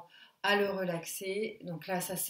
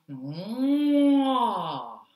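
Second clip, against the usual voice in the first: long meuglement aigu et fort; meuglement de vache; 9 dB louder than usual. Shown as a demonstration. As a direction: imiter une vache à pleine voix. Exercice de la vache, cri. (meuglement de vache sonore)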